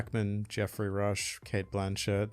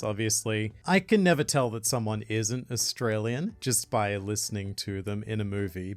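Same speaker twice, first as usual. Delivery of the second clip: usual speaking voice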